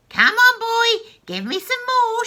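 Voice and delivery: very high, loud bossy yell; greedy old crone hollering. Acted. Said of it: "she yelled".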